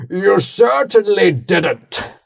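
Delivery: high, very slow theatrical villain voice; Imperator Stephen voice. Acted. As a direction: drawl it out like a smug tyrant. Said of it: "That's my Imperator Stephen voice".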